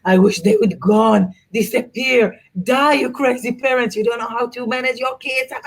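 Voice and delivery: mock hostile muttering, pitch climbing on the curses; hateful passengers. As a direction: voice the fed-up passengers cursing the parents. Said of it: "you could feel the hatred".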